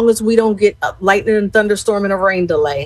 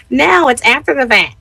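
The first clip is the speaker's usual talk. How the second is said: Crystal's talk; 5 semitones higher than usual. Retold or imitated. Friend refusing a Sunday cookout, imitated higher voice. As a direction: mimic your friend shooting the plan down. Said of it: "That's how she talks".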